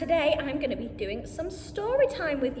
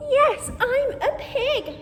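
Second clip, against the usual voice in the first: higher, slow, louder little pig with a laugh; piggy voice; 7 semitones higher, 4 dB louder than usual. Acted. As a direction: play the pig, giggling. Reading farm book, pig speaks.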